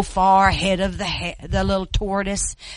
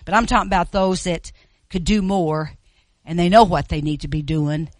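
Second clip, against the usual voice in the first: ordinary talk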